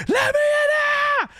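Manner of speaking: high, loud scream to be let in; full-throated retold shriek at a locked door; panicked scream. Stepfather-chased storyteller acts out begging at the door, screaming.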